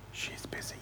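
put-on hushed child's whisper on the phone; little boy whispering. A narrator's reading. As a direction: keep it secret, breath only. Hiding child answers the pastor.

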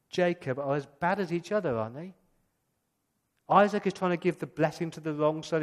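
ordinary delivery

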